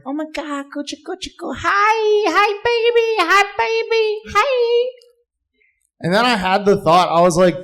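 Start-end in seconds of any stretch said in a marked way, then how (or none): 0.1-4.9 very high cooing squeal; gushing baby talk. Acted. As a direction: coo at a baby in exaggerated falsetto. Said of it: "There was a baby, and I was like".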